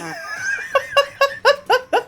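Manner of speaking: high, breathy giggling fit; helpless laughing. Real, not acted. Streamer losing it over his own story, laughing. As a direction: crack up mid-story.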